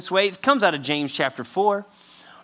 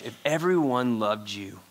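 ordinary talk

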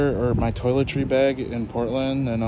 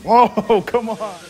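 laughing; 10 semitones higher, 8 dB louder, slower than usual. Real, not acted. Friend says fuck you.

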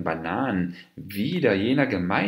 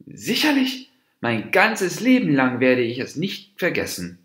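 indignant; 6 semitones higher, 3 dB louder than usual. A narrator's reading. Deer angrily answers the monkey.

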